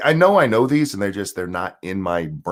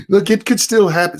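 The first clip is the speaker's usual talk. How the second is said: high-pitched, louder mock optimism; hopeful insistence; 10 semitones higher, 4 dB louder than usual. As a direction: imitate someone clinging to hope, pitched up. Voicing wishful anti-Trump people.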